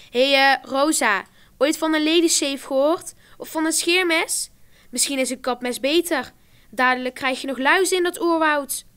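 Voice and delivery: loud, high mocking teasing; mock her for fun; jeering taunt. A narrator's reading. Classmate taunting Rosa about her hairy legs, mocking.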